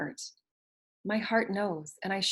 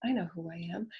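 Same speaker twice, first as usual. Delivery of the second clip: whispered affirmation; 8 dB quieter than usual. Shown as a demonstration. Teacher repeating the affirmation in a whisper. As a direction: whisper "I know who I am". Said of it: "repeated affirmation".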